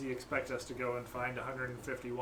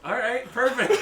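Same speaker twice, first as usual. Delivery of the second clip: laughing; 12 semitones higher, 15 dB louder than usual. Real, not acted. Player bursts out laughing.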